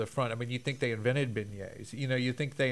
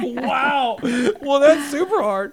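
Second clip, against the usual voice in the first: much higher, louder laughing exclaim; 13 semitones higher, 12 dB louder, slower than usual. Real, not acted. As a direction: laugh through it, amused. Laughing at the hard word.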